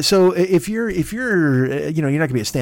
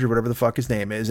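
ordinary delivery

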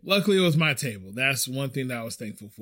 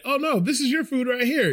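higher-pitched mock-helpful server voice; flustered self-correction; 10 semitones higher than usual. Acts out correcting himself at table.

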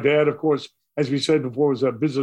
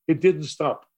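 ordinary delivery, nothing marked

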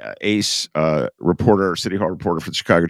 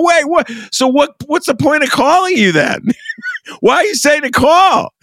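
incredulous outburst. Retold or imitated. Host retelling his reply to the mayor, high incredulous outburst. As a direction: burst out in shocked disbelief with a laugh. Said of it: "I go".

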